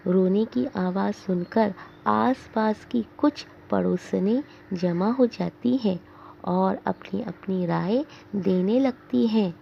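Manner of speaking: plain reading voice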